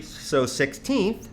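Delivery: ordinary delivery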